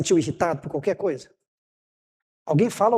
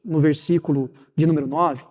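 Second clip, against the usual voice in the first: ordinary delivery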